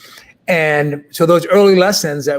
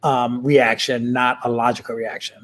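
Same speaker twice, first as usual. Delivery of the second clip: ordinary delivery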